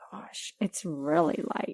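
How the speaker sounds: soft, slow whisper. Real, not acted. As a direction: breathe it out in awe. Whispered reaction to pale wine.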